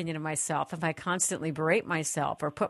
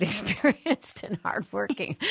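laughing; 4 semitones higher, slower than usual. Real, not acted. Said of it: "I shouldn't laugh".

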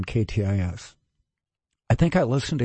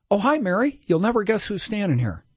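much higher-pitched, chirpy retold greeting; imitated phone greeting; 8 semitones higher than usual. Hostess telling her sister on the phone who is there, imitated high-pitched greeting. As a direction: lift the pitch high to share news.

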